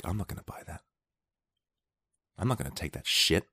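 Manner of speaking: imitating a stubborn mutter; muttered refusal. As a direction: mumble the refusal to yourself. Voicing stubborn people refusing.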